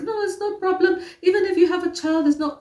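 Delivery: high, eager mock reassurance from the suitors; imitating the men. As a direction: play the keen young men brushing it off. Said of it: "they were like".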